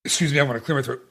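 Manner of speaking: normal talking voice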